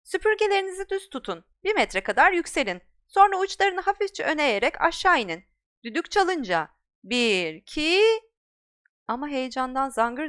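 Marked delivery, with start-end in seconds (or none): 0.0-8.0 high, loud commanding teacher; brisk instructor's command; stern flying instructor. Acted. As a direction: bark orders at the class. Instructor giving flight orders.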